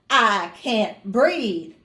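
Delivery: slow, drawn-out plea; slow pleading. Retold or imitated. To the doctor about the mask, slow drawn-out plea. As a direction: drag the words out, pleading for air.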